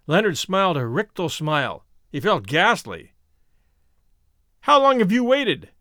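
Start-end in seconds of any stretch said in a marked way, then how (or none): 4.6-5.7 high-pitched, urgent question; demanding. A narrator's reading. Man questioning the warrior spirits, demanding. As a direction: demand an answer from them. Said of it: "he demanded".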